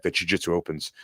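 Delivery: normal talking voice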